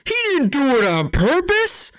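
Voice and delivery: high, loud protesting yell; loud high-pitched heckle from the stands; fan yelling. Retold or imitated. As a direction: shout it up at the ice in protest. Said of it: "he stands up and he yells".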